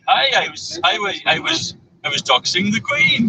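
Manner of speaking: put-on high, slow, joking Welsh accent; mock Welsh accent. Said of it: "Tom's doing some Pakistani accent".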